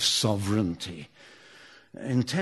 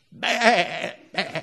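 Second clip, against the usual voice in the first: sheep bleat; 8 semitones higher, 7 dB louder than usual. Shown as a demonstration. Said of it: "That was Lancashire sheep counting".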